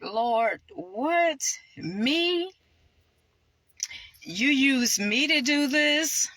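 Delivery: high-pitched, incredulous questions to God; acted disbelief. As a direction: marvel in a rising voice at being chosen.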